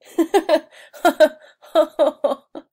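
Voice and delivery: high, loud laughing fit. Real, not acted. Vlogger seeing her crying picture, laughing hard. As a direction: crack up laughing. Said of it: "I can only laugh about it now".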